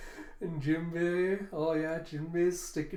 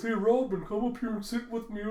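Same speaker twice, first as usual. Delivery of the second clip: high, loud, eager call; flirty invitation; 7 semitones higher, 4 dB louder than usual. Acted. Suitor inviting Robin to sit with him, eager.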